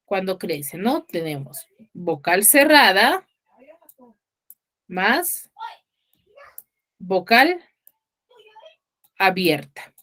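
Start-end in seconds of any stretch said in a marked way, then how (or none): 1.0-9.9 slow dictation. Shown as a demonstration. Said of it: "¿Por qué decimos creciente?".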